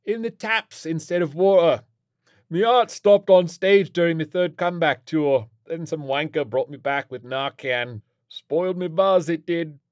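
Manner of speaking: loud, high British rocker rant; raucous washed-up British star; cheerful old rocker. A narrator's reading. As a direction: spin a merry rock-star anecdote loudly. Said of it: "he shouted cheerfully".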